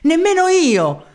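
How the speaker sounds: high, loud, slow refusal; a second woman refuses to stay, crying out; defiant cry. Acted. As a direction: refuse outright, loudly.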